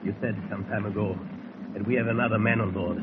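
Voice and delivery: low, hushed conspiring voice; hushed suspense. Acted. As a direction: murmur it so nobody hears. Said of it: "a hushed voice rises".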